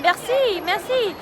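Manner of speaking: hammed-up French accent, high and bright on "merci, merci"; mock French accent. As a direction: play a tourist putting on a French accent. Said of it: "I was talking in a French accent first".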